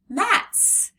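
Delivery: mats jumped high and loud; cheerful read-along model; bright excited reading. Shown as a demonstration. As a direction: pop the word up high. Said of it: "M-ats".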